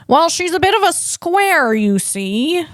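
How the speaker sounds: high, old-film twang of a bad impression; terrible Jimmy Stewart. Mocking himself as a square, in a terrible Jimmy Stewart voice. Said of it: "a very terrible Jimmy Stewart".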